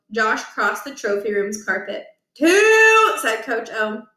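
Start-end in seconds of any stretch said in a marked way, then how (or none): 2.3-3.1 countdown shout. Acted. Coach counting down.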